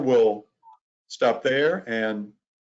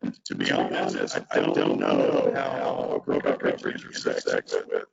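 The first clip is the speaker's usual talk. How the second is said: deep, distorted glitchy audio voice; monster voice; 14 semitones lower than usual. Real, not acted. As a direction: sound like a warped low monster over a bad connection. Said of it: "a terrible monster voice".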